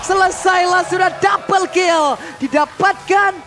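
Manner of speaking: rising hype play-by-play call; fighting game announcer. Acted. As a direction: build it up to a big kill shout. (komentator esports yang makin heboh)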